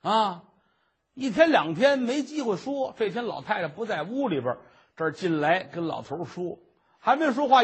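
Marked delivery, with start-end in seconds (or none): none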